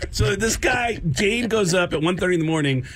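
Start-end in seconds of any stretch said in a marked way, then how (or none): none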